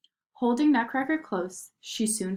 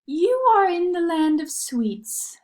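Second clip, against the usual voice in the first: high, slow sweet fairy voice; sugar plum fairy; 9 semitones higher, 6 dB louder, slower than usual. A narrator's reading. The fairy greeting Marie at the gates, high and slow. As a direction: sing it out like a dainty fairy. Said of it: "said the sugar plum fairy".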